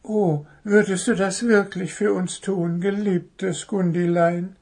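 high little bird voice, hopeful; expectant bird. Acted. Little bird asks the crow hopefully. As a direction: chirp it sweetly, hoping. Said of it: "fragte Zwitschi erwartungsvoll".

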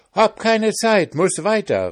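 high, boastful pancake voice; cheeky pancake. A narrator's reading. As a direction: taunt them from high up. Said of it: "rief der Pfannkuchen".

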